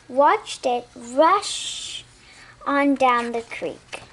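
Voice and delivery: ordinary delivery, nothing marked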